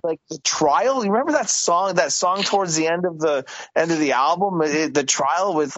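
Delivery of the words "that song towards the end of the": ordinary talk, nothing marked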